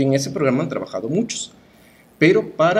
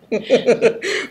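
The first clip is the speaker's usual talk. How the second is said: high laugh; 10 semitones higher, 4 dB louder than usual. Real, not acted. Laughs at poet cliché.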